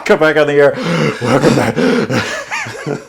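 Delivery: breathless radio DJ voice; imitating a winded DJ; radio host. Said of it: "when I was in radio".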